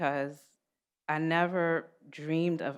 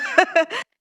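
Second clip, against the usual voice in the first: laugh; 11 semitones higher, 8 dB louder than usual. Real, not acted. Laughs after proud quote.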